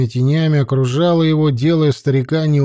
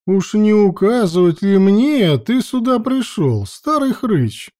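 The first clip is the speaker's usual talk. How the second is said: higher, haughty mocking question; 7 semitones higher than usual. A narrator's reading. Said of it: "Высокомерно осведомилась Локша".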